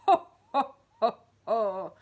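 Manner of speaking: loud booming giant laugh; thunderous laugh. Acted. Giant laughing down at Mister Greedy, booming. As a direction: let the laugh roll like thunder. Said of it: "The giant laughed, a laugh as loud as thunder".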